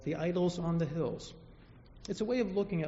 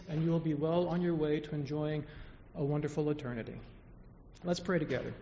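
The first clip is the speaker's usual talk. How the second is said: ordinary talk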